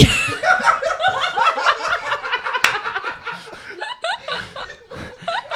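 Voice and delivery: long loud high-pitched laugh; breaking up with laughter. Real, not acted. Laughing at teacher nickname.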